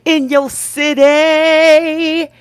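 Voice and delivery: big, drawn-out hype announcer read; stretching the last words for the crowd; announcer intro. Acted. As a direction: sell it like a ring announcer.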